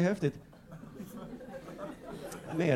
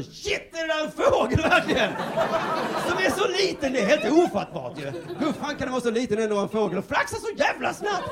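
much higher, loud, astonished shouting; amazed commentator; 12 semitones higher, 3 dB louder than usual. Retold or imitated. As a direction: shout in disbelief at the tiny bird. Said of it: "kommentatorn bara så". (högt, häpet skrikande kommentator)

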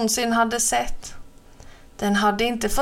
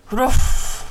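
a loud, gruff animal bark-growl; short gruff woof; beast growl; 2 semitones higher, 6 dB louder than usual. Acted. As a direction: growl it like a big wild beast. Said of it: "morrade besten".

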